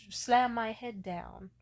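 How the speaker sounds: ordinary talk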